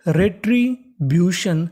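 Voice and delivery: Retribution drawn out slowly, syllable by syllable; slow model word. Shown as a demonstration. Teacher modelling the assembled word slowly for students. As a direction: stretch retribution so students catch every sound. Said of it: "तो meaning बन गई".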